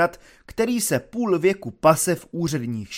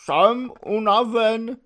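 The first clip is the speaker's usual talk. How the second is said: higher, slow, theatrically pained; tragic voice; 8 semitones higher, slower than usual. Acted. Dub declaring his exhaustion to the officers, hammed-up tragic. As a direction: play the dying martyr. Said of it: "řekl tragickým hlasem".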